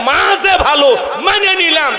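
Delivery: high, loud proud shout; proud mother. Retold or imitated. Mother boasts the good child is her doing.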